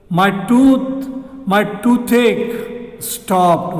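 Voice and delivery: spelled out slowly, word by word: "my toothache stopped"; slow model sentence. Shown as a demonstration. Teacher giving the correct sentence slowly to students. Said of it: "We can say".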